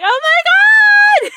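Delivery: high, loud, slow fangirl squeal of delight; excited squeal. Retold or imitated. To the Gilmore Girls clerk, squealing with joy. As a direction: shriek it out, stretched and thrilled. Squealing over daughter named Lorelai.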